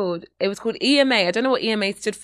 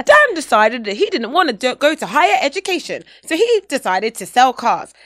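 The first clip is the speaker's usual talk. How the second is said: upbeat American promo voice, louder; American accent; 3 semitones higher, 6 dB louder than usual. Pretend. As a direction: pitch it like a US school ad. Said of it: "I don't know why I'm doing American accent".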